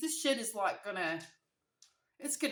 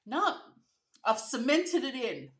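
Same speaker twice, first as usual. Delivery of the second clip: loud, higher dismissive retort; insistent dad; 4 semitones higher, 9 dB louder than usual. Retold or imitated. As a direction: imitate a stubborn dad brushing it off. Dad's stubborn retort acted out.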